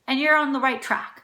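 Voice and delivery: usual speaking voice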